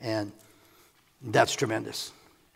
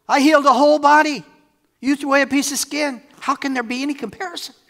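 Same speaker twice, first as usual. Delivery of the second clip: high, loud argument; loud incredulous protest; heated rebuttal; 13 semitones higher, 10 dB louder than usual. Acted. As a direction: argue back at full voice, then ease slightly. Jesus arguing back at accusers.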